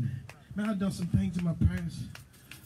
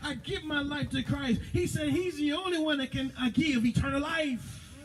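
high, fervent preacher's cry; preaching; 9 semitones higher than usual. Acted. Preacher rousing the congregation, fervent preaching. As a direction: preach it out full force to the church.

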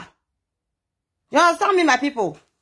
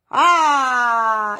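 anguished crying wail; 2 semitones lower than usual. Real, not acted. Wails while recounting her grief.

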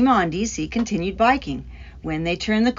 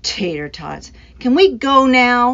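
put-on whiny child's plea, louder at the end; begging; 4 semitones higher, 6 dB louder than usual. A narrator's reading. Boy begging his grandmother to go, whiny plea. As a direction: beg grandma to leave, like an impatient kid. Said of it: "begged D C".

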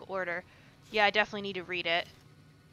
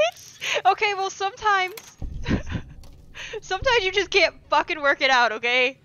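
laughing defensively; 9 semitones higher, 8 dB louder than usual. Real, not acted. Defending after failed lockpicks.

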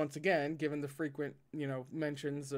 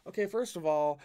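plain reading voice

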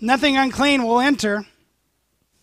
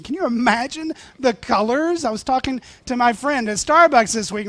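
ordinary talk, nothing marked

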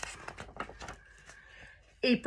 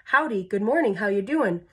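repeated greetings; faster than usual. Acted. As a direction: run of rattled-off, singsong hellos. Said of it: "six hundred more of them in line".

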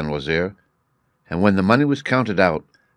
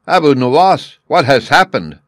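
sharp, louder and higher cry of alarm; 7 semitones higher, 7 dB louder than usual. A narrator's reading. Sultan cries out in alarm as Abu Nuwas enters weeping. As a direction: call out to him in shock. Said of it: "cried the Sultan".

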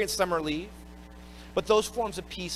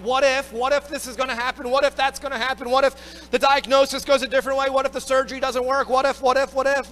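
higher, louder anxious rush of what ifs; mock panic; 6 semitones higher, 5 dB louder than usual. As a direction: hit the panic button, pitch climbing. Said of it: "you hit the panic button".

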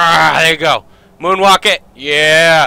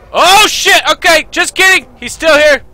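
high, loud burst of alarm; real startled yell; 12 semitones higher, 6 dB louder than usual. Real, not acted. Gamer finding the dragon still here, startled yell.

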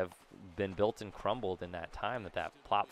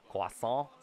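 croissant said the French way, "kwah-sohn"; French accent. Shown as a demonstration. Player toys with a fun word, French accent. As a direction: say croissant in French, dropping the final t. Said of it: "say in a French accent".

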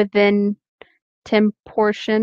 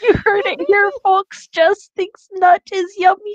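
higher, teasing broadcast voice; mock announcer; 11 semitones higher than usual. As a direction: announce the gossip to the crowd.

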